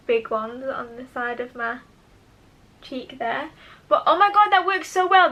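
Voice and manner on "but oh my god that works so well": high, loud delighted burst; thrilled exclamation; excited. Real, not acted. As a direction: gush with real delight at the result. Delighted at product result.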